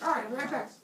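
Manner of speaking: high put-on helper voice; reply high and dash away; eager messenger. Hermes leaves for Earth, high eager sidekick.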